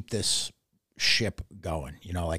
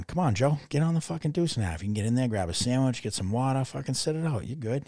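higher-pitched urging, lightly acted; quick, raised-pitch coaxing; coaxing drill sergeant; 6 semitones higher than usual. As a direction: imitate a sergeant tempting someone to give up. Imitating sergeant coaxing runners to quit.

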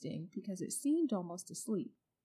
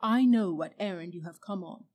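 witch speaking; 9 dB louder than usual. A narrator's reading. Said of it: "continued the witch".

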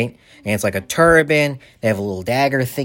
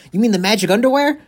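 excited blurt; 11 semitones higher, faster than usual. Retold or imitated. Blurts out the slang term.

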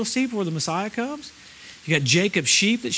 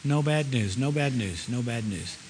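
softer and lower each time, trailing away; fading repetition; 8 semitones lower, 7 dB quieter, slower than usual. Acted. Preacher repeating reassurance to the church, fading softly. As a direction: let it fade as you repeat it. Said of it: "I should say it over and over".

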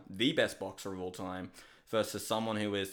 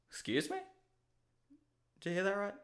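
mock surprise; 11 semitones higher, 4 dB quieter, faster than usual. Mock disbelief at own announcement.

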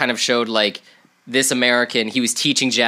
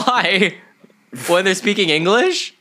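high, loud disbelieving question; surprised; 9 semitones higher, 5 dB louder than usual. Real, not acted. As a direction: sound genuinely taken aback. Incredulous question about English speakers.

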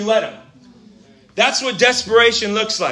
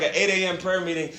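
ordinary delivery

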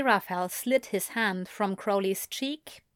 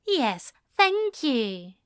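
slow, higher, kindly thanks; much kinder; 5 semitones higher, slower than usual. A narrator's reading. Aziraphale thanking the waiter after Crowley, much kinder. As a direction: soften it kindly, drawing it out. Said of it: "said in a much kinder voice".

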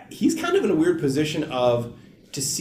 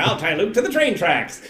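lightly hammed mustache-twirling villain; a hint of a melodrama baddie; twenties villain; 7 semitones higher than usual. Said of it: "it was like a twenties villain".

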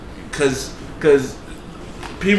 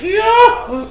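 high-pitched imitation of a tearful wail; hammed-up crying voice; mock boo-hoo crying; 4 dB louder than usual. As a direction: wail it like a blubbering uncle, exaggerated. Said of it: "boo-hoo crying".

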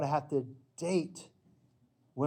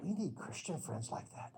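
sincere aside; 2 semitones higher, 10 dB quieter than usual. Acted. Quiet refrain after story.

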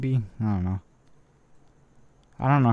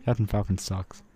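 ordinary talk, nothing marked

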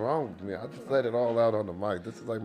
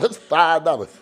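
loud, higher comeback; loud retort; 6 semitones higher, 10 dB louder than usual. Retold or imitated. Repeating the comeback to his cohost, loud high retort. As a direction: bark it back at him.

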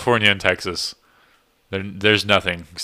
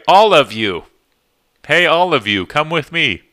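higher and louder, overly proper "All of you"; mock formal Californian; 8 semitones higher, 7 dB louder than usual. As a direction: send up how Californians talk, stiff and proper. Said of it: "they say".

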